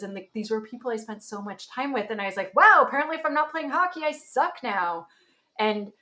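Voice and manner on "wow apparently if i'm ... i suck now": higher and louder, dragging out the last words; sarcastic self-pity, slowing on the end; mock bitter realization. To herself after hockey friends drop her, mock outrage.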